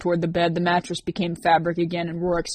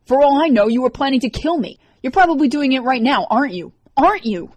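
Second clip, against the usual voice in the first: higher, louder accusing rant; furious accusation; 7 semitones higher, 7 dB louder than usual. A narrator's reading. Liz to Rorik, furious accusation. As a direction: press him harder on each question.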